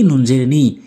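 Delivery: usual speaking voice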